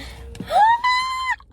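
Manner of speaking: sharp, high, loud gasp of delight. Real, not acted. Vlogger uncovers the last item in her box, loud delighted gasp. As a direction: gasp as if the gift stuns you.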